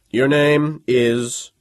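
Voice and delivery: pen ready, drag out the question; officer demanding a name. A narrator's reading. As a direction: major taking down the student's name. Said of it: "Major Casals said".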